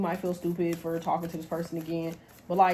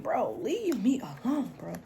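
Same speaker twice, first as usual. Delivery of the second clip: mock exasperated plea; 3 semitones higher, slower than usual. Voicing exasperated plea to clingy ex.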